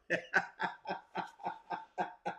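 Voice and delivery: soft airy chuckle to himself; quiet amused laugh off mic; breathy laugh. Real, not acted. Laughing at own remark.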